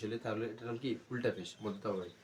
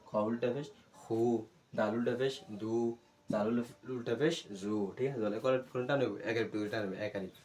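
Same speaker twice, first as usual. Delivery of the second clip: recitation drill. Shown as a demonstration. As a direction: chant each letter in a steady rhythm. Recites letter drill to students.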